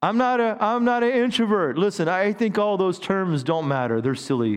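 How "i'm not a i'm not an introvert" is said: higher-pitched imitation of a reluctant member; mock excuse-making. Church member making excuses to pastor about serving, mock protest.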